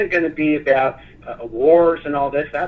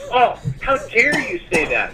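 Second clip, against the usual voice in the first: mock offended indignation; 8 semitones higher than usual. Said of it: "They're like".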